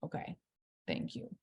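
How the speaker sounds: whispered grateful acceptance; relieved thanks. Pretend. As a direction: breathe it out, touched and relieved. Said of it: "you're like".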